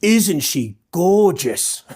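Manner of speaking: drawn-out, hammed-up Aussie documentary voice; documentary presenter. Photographer mimicking a documentary for viewers, hammed-up presenter voice. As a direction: stretch it slowly like an exaggerated nature presenter. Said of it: "like I was in a documentary".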